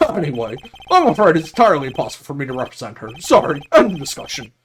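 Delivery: fast, blustering brush-off; pompous lawyer, dismissive. Acted. Lawyer refuses to represent her, pompous and hurried. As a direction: shut the matter down grandly.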